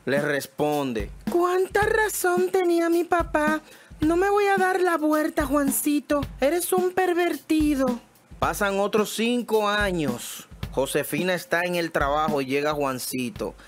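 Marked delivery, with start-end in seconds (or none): 1.3-8.0 much higher, scolding woman; indignant wife. Acted. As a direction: tell him off, high and offended. Wife refuses again.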